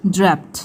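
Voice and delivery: ड्रैप्ड ending in "pt"; final D as T. Shown as a demonstration. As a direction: say ड्रैप्ड with a voiceless t at the end. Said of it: "डी का sound ट आएगा". (ड्रैप्ड के अंत में ट की आवाज़)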